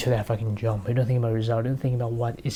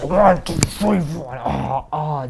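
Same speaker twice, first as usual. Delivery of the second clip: higher, louder effortful count; straining count; 4 semitones higher, 4 dB louder, much slower than usual. Acted. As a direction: force out each number on the climb. Counting steps with last strength.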